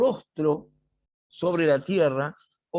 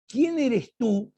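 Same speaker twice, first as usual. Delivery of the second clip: playing dumb; 7 semitones higher, slower than usual. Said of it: "se hace el pavo".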